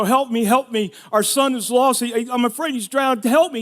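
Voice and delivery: loud, high plea for help; panicked yelling. Retold or imitated. Father yelling to strangers for help, frantic. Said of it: "I'm yelling at people I don't know".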